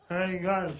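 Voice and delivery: high, slow, drawn-out tough fish telling his tale; mock fish buddy. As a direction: play the fish as a streetwise guy warning his pals.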